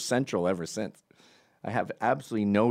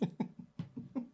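breathless wheezing laugh; 13 dB quieter than usual. Real, not acted. Laugh alone.

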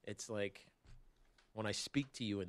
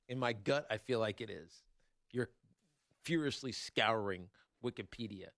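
ordinary talk

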